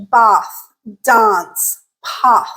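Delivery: British broad ah in bath, dance, path; British English pronunciation. Shown as a demonstration. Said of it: "this would be like in British English".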